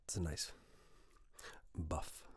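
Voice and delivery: normal talking voice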